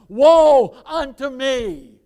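loud, slow lament; crying out. Acted. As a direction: wail it slowly, full voice. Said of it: "He cried out".

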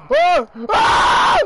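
high, loud screams; panicked screams. Real, not acted. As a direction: scream out in short bursts. Screams while attacked in game.

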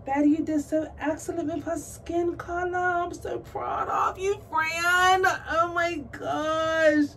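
high, gushing praise; thrilled praise. Real, not acted. Praising Betty's skin color work, thrilled gushing. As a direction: pour out pride in a high voice. Praising doll maker, overwhelmed.

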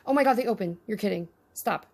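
normal talking voice

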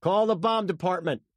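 loud, high command; urgent order. A narrator's reading. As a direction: shout instructions to a pilot. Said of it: "California told the pilot".

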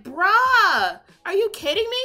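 loud, high "bruh" of disbelief; answering the sports doctor, loud and exasperated. Retold or imitated. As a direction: push back loudly, incredulous.

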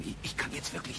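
hurried stammering whisper. Acted. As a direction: whisper it nervously, cutting yourself off. Lambert hushes woman near police. (hastig flüsternd)

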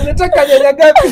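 put-on high Kikuyu market mom accent. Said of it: "The Kikuyu mom accent".

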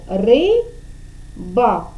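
"риба" split into "ри", long pause, "ба"; syllable by syllable. Shown as a demonstration. Teacher dividing a word for a child, "риба" syllable by syllable. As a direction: say "риба" slowly in two separate syllables. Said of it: "ділимо за допомогою долоньки на склади".

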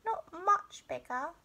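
high small cub voice, drawn out slowly; slow high-pitched cub complaint; little bear cub, slow. A narrator's reading. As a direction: stretch it out like a grumbling little cub.

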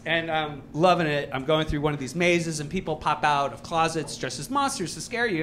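ordinary talk, nothing marked

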